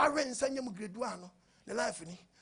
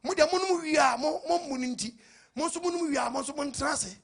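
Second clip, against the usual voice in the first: raised preacher delivery, pitched up; preaching call and response; 3 semitones higher, 3 dB louder than usual. Acted. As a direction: drive the crowd to answer back.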